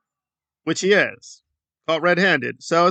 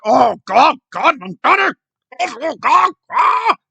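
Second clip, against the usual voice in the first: higher, louder hammed-up panic ending in a yell; mock freakout; 7 semitones higher, 6 dB louder, slower than usual. Mocking Trump fans who meltdown over others' lies, hammed-up yell. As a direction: ham up a meltdown, climbing into a shriek. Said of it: "they get".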